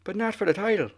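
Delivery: high, lilting Irish brogue impression; hammed-up Irish champion making a promise; mock Irish accent. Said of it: "I can't do the Irish accent like you".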